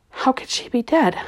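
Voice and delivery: plain reading voice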